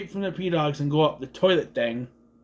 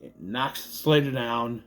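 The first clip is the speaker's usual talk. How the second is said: normal talking voice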